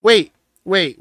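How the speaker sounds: loud urgent interruption. Real, not acted. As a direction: cut in loudly to stop him. Friend stops the scenario.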